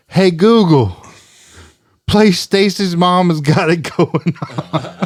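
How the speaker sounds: high, slow, laughing party guy; party neighbor voice. Retold or imitated. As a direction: imitate a tipsy neighbor calling out. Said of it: "And I hear 'em go".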